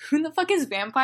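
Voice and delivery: ordinary talk, nothing marked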